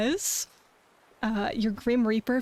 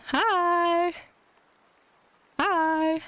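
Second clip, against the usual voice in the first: cute anime streamer; 7 semitones higher than usual. Real, not acted. Greeting chat.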